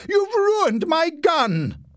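high, slow, loud rage; furious shout. A narrator's reading. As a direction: blast him for the ruined gun. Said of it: "shouted Leslie".

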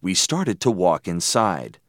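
ordinary delivery, nothing marked

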